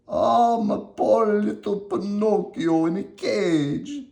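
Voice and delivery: put-on an old father grieving, slow and high; worried Geppetto. Geppetto hearing his son is caged, slow and worried. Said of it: "said Geppetto".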